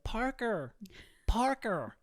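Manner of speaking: lightly voiced girl calling to a boy; calling out a name. American teen girl calling a boy by the pool, lightly voiced. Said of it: "she went".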